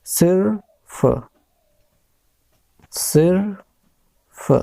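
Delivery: sounding out सिर्फ slowly; slow spelled-out demo. Shown as a demonstration. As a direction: stretch सिर्फ out slowly for the class to hear. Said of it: "जैसे हमको सिर्फ लिखना होता है".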